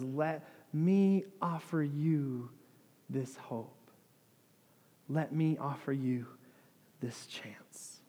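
slow, hushed, fading invitation; tender offer. Retold or imitated. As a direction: offer it softly, pausing before the gift. Jesus offers hope to the hurting.

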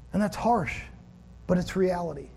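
normal talking voice

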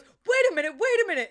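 high, loud flustered protest; breathless Cher. Retold or imitated. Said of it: "She goes".

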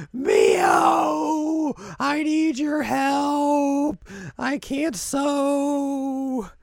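high, drawn-out wail; hammed-up bawling for help, very slow; mock crying. Ritsu barrels in begging Mio, wailing. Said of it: "like, crying".

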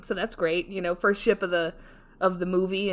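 normal talking voice

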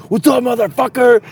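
high, loud challenge; retold battle cry; confrontational shout. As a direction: burst out yelling at the intruder. Bursts out of tent confronting intruder.

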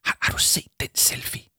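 emphatic voiceless whisper. Acted. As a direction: whisper the question pointedly. Whispered talk under the coat.

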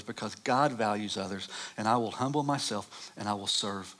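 usual speaking voice

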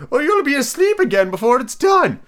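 high, loud, theatrical warning; hammed-up bossy eccentric; impatient Hatter. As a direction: scold loudly in a high voice. Hatter voiced in story.

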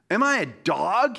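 mocking roar at a small boy; roaring giant. A narrator's reading. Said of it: "he roared at David".

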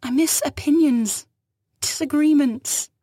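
high, loud, slow grieving cry; building outcry. Acted. Fugitive cries out her losses to the captain, swelling. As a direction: let the longing swell to a cry.

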